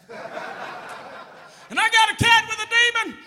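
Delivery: high, loud calling out; hammed-up baptism fervor; mock revival shouting. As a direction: holler like kids playing church. Children playing church baptism, calling out.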